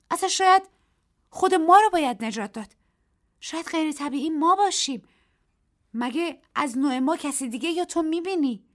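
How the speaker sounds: high, loud protest; heated argument. A narrator's reading. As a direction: fight back hard. Daisy argues heatedly. (با تندی و صدای بلند)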